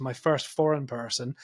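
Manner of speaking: ordinary talk, nothing marked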